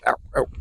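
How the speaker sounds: sharp pained yelps; ouch. Real, not acted. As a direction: yelp at the prickle. Pricked by a desert bush.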